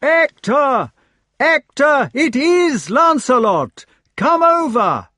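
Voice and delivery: high, loud long-distance call; full-force shout. A narrator's reading. Knight calling his companion from the gatehouse, shouting. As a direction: shout with all your might. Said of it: "shouted with all his might".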